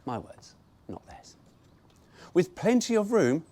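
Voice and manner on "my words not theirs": breathy unvoiced throwaway; whispered aside. Real, not acted. Disowning the brochure's words to listeners, whispered. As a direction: drop it to a whisper.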